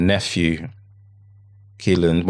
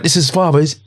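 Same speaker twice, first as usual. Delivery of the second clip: sharp, high and louder, half-acted; retold angry outburst; 10 semitones higher, 5 dB louder than usual. A man at the scene, angrily retold by the father. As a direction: throw the man's anger back as you retell it. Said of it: "And he's just like".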